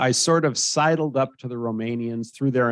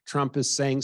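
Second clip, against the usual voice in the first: usual speaking voice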